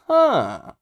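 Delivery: knowing huh. Real, not acted. Noticing foreshadowing of cold powers.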